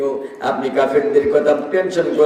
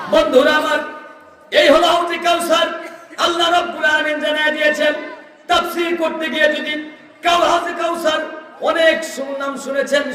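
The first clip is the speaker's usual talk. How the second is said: high, ringing sermon call; fervent preaching; 10 semitones higher than usual. Real, not acted. Preacher presenting the fountain to his friends, high fervent preaching. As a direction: proclaim it high to the listeners.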